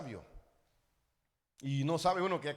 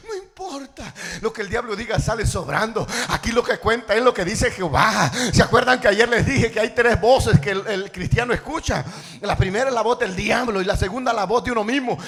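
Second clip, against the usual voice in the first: raised, emphatic sermon voice; forceful preaching; 4 semitones higher, 14 dB louder than usual. Real, not acted. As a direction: drive the point home to the congregation. (predicando con voz fuerte)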